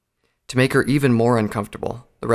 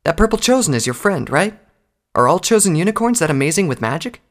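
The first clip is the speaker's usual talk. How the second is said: high, eager questioning voice; curious mare recruit; 7 semitones higher, 4 dB louder than usual. Acted. Recruit quizzes Applejack about her friend, high and curious. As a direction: quiz a comrade in a higher mare voice.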